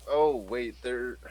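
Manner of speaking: ordinary talk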